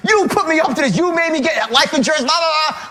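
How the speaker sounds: high, accusing mock yell; yelling blame. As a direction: rail at your partner as if it's all their fault. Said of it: "I'm still yelling at her".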